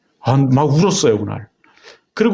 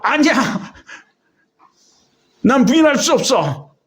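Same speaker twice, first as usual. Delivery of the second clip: much higher, mock shouted refusal; retold crying shout; 9 semitones higher than usual. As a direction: cry out the refusal high and strained, as retold. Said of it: "막 소리를 지르고 울고".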